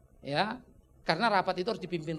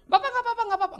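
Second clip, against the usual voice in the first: high, louder eager reassurance; eager host; 6 dB louder, faster than usual. Retold or imitated. Host insisting to the pastor it's fine, high eager voice. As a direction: gush that it's fine, sir.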